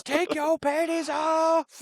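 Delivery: high, slow, stretched taunt; mock high taunt. Friend quoting Craig's taunt, high and slow. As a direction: draw it out high and silly.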